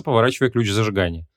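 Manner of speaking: ordinary delivery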